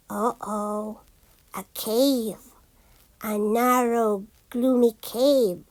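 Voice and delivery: low, soft and wary; hushed cave voice. A narrator's reading. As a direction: creep into the cave, voice dropped low. Entering a gloomy cave.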